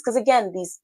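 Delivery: ordinary talk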